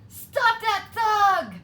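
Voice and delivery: very high, loud, slow cry; cried. A narrator's reading. Birds crying out for the fox to be stopped. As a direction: shout for someone to catch him. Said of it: "cried the birds".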